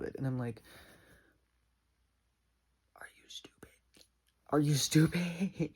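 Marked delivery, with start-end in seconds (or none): 3.0-4.0 barely audible whisper; hushed, voiceless disbelief. Acted. Acted confrontation, whispered first take. 4.5-5.7 loud, drawn-out exasperation; stretched, raised-voice incredulity; exasperated outburst. Acted. Acted confrontation, repeated louder.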